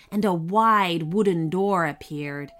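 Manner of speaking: ordinary delivery, nothing marked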